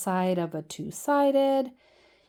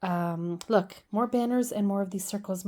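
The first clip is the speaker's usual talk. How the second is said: usual speaking voice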